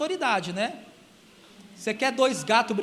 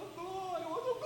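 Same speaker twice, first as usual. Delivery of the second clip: mock showy praise; 7 semitones higher, 15 dB quieter than usual. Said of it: "Às vezes a pessoa fala".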